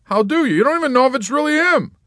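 high, loud, fast needling; Yossarian. A narrator's reading. Yossarian badgering the nurse, high and fast. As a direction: press her with a pile of questions.

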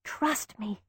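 very soft reassuring whisper; calm her in a hush; quiet reassurance. A narrator's reading. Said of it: "I told her".

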